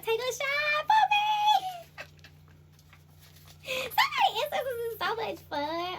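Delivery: very high, slow, loud laughing; real laughter; laughing shout. Real, not acted. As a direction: cackle out the line. Laughing spirit voice.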